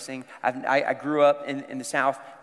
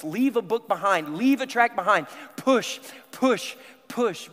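raised, louder voice driving them on; insistent urging; 5 semitones higher, 5 dB louder than usual. Acted. Preacher voices the Spirit prodding believers to act, insistent urging. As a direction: urge them on like a coach on the radio.

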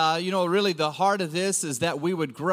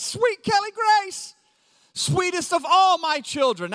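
tender, doting; 9 semitones higher, 4 dB louder than usual. Shown as a demonstration. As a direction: coo her name like a proud dad. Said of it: "Kelly Grace is just sweet".

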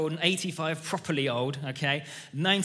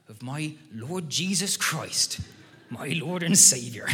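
put-on another old boy in countryside accent. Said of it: "And he says".

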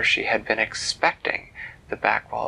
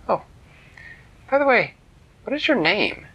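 high bright eager question; excited; 11 semitones higher, 5 dB louder than usual. Acted. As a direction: ask with giddy curiosity. Girl asks eagerly.

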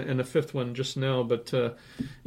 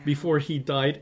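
ordinary talk

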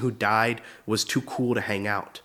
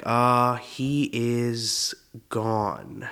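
very slow, hesitant stretched-out words; evasive euphemism; much slower than usual. Acted. Politely telling wedding guest uncle died, evasively. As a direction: pick each word slowly, walking around it. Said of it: "you have to walk around it".

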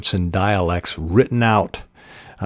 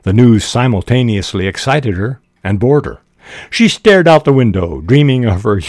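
animated, louder story reading; story narration; 3 semitones higher, 6 dB louder than usual. Acted. Reading a student's romantic story to listeners, animated narration. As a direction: read the story out with lifted pitch and volume.

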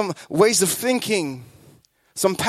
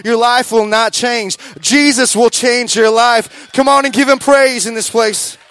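full-force preaching; 3 semitones higher, 5 dB louder than usual. Acted. Preaching builds to climax.

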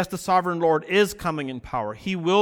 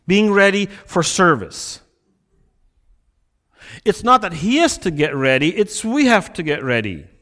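louder, driving sermon delivery; preaching at full force; 8 dB louder than usual. Acted. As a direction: preach it out to the congregation.

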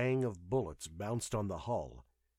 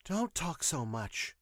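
higher, slow female voice, mildly chiding; admonishing nurse; 7 semitones higher, slower than usual. A narrator's reading. Nurse quiets her talkative patient, admonishing. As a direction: gently tell the patient off, drawn out. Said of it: "the nurse admonished".

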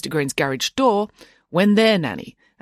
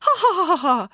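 high, stilted ha-ha-ha; badly faked human laughter; fake laugh; 13 semitones higher than usual. A narrator's reading. Rival nanny feigning friendliness, forced fake laugh. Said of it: "mimicking the noise of normal human laughter".

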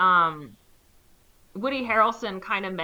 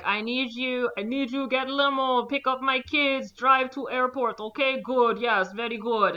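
lightly higher, a hint of a Russian accent; broken-English Russian; 4 semitones higher than usual. Russian rich man ordering driver, hint of a Russian accent.

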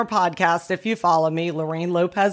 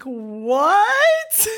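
high, swooping mock shriek; drawn-out disbelief, imitating a friend; incredulous friend; 8 semitones higher than usual. Best friend on the phone hears it, shrieking in disbelief. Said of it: "And then Lorraine is like".

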